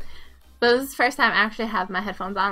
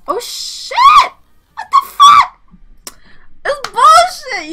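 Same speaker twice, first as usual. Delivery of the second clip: very high, loud scream; shrieking, drawn-out gamer rage; outraged screaming; 14 dB louder, much slower than usual. Real, not acted. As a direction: scream in disbelief at the game. Losing in the game.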